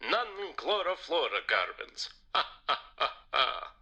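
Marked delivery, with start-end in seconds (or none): 2.3-3.7 spelt-out ha ha ha, hammed up; a smug vampire cackle, acted; mock villain laugh. Vampire gloating at the butler, hammed-up ha ha laugh.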